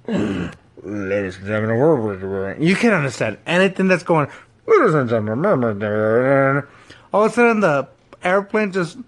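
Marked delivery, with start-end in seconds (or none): 0.1-2.6 pilot announcement voice. Retold or imitated. Retelling the flight, imitating the captain's mumbled low announcement. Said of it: "typical captain stuff". 4.7-6.6 low, muffled captain on the intercom, slower; pilot announcement voice. Retold or imitated. Retelling the flight, imitating the captain's mumbled low announcement. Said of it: "typical captain stuff".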